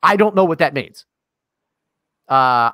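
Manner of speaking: usual speaking voice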